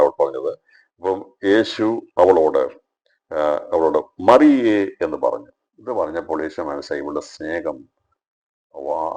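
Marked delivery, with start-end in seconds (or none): 4.3-4.8 high, loud calling of her name; പേര് വിളിച്ച്. Acted. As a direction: call മറിയേ out clearly. Jesus calls Mary by name. (മറിയേ എന്ന് ഉറക്കെ വിളിക്കുന്നു)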